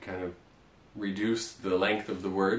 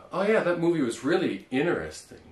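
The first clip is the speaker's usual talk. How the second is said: lightly acted casual remark; a casual chat line, just a bit higher; example sentence; 7 semitones higher than usual. Shown as a demonstration. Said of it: "you could say like".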